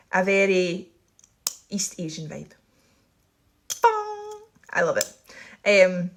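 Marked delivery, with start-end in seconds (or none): 3.8-4.4 high sung-out gong noise; mock gong. Joking after correcting herself, mock gong. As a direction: ring out a playful gong sound.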